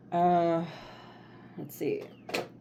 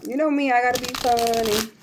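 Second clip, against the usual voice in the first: playful laugh; 7 semitones higher, 5 dB louder than usual. Real, not acted. Playful joke about herself.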